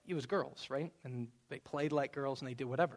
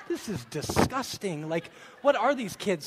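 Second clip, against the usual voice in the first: disgusted mom; 4 semitones higher, 7 dB louder than usual. Retold or imitated. Preacher imitating his wife at the boys' bath time, hammed-up disgust. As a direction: drag out the disgust in mock horror. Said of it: "she's like".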